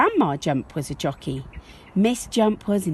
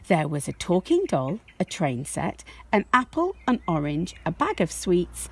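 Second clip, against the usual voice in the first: plain reading voice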